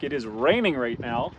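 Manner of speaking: normal talking voice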